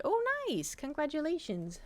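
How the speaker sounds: high, bright oh nice; pleasantly surprised at the news; reacting to a viewer's engagement with surprise; delighted surprise. Real, not acted.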